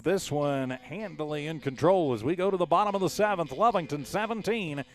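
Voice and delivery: big, loud, high strikeout call; excited call. Real, not acted. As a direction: shout the final strike with a lift. Strikeout ends big inning.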